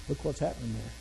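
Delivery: low, soft voice of God; small inner voice. Retold or imitated. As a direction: speak softly, like an inner prompting. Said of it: "that small voice on the inside".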